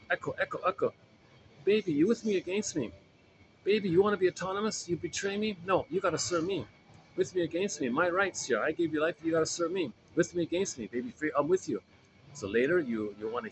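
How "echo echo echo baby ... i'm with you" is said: much higher, possessive mother pleading; clingy mother voice. Retold or imitated. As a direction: play the mother clinging to her baby, high-pitched. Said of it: "But the memory says".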